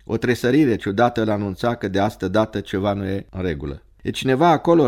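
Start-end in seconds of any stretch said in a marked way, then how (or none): 4.0-4.9 higher, uneasy questioning call; uneasy repeat. A narrator's reading. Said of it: "repetă el întrebarea neliniștit". (întrebare repetată neliniștit)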